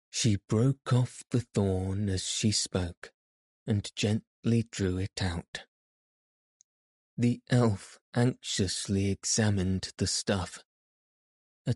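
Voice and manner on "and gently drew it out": ordinary delivery, nothing marked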